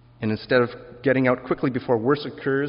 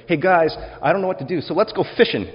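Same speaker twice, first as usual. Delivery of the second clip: higher and louder, breezy and offhand; casual Peter; 8 semitones higher, 5 dB louder, faster than usual. Acted. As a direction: Peter shrugging it off to his friends. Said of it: "The one who said".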